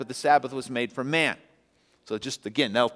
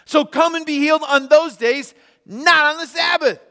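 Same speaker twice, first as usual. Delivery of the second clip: a stern official scolding the people; indignant rebuke; 13 semitones higher, 8 dB louder than usual. Acted. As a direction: synagogue leader berating the crowd for healing on the Sabbath. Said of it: "the synagogue leader said to the people".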